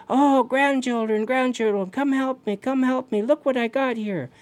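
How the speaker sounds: high, eager storyteller's call; calling grandchildren. Acted. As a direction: call the little ones over from afar. Raven calls distant grandchildren for help.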